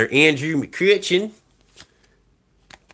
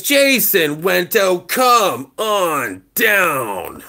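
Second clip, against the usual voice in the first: game show host; much slower than usual. Acted. Game show call to contestant.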